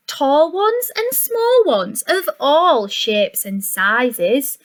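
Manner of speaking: plain reading voice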